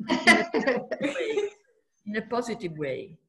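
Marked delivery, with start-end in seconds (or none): 0.1-1.5 higher and louder, laughing. Real, not acted. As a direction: laugh through "in a positive way". Laughing, then repeats the phrase.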